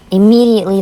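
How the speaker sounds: ordinary talk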